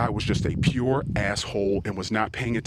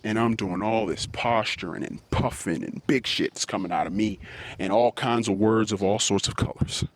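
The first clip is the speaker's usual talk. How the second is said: ordinary delivery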